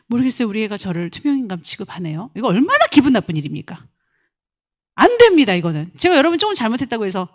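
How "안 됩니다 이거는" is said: normal talking voice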